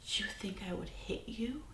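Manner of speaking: hushed, slow disbelieving whisper; mock-hurt, stunned whisper; shocked whisper. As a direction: breathe it out slowly in disbelief. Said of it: "he looked at me shocked".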